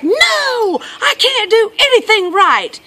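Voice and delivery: high, loud, drawn-out wail; upset boy. Retold or imitated. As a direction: imitate a child's despairing outburst. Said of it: "he's like".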